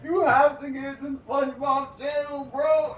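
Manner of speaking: high, loud, slow words through laughter; laughing hard. Real, not acted. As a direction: talk while cracking up. Laughing hard while talking.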